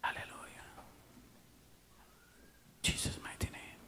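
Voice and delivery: hushed, breathy worship whisper; whispered prayer. Real, not acted. Preacher praying quietly over the congregation, whispered. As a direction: pray under your breath.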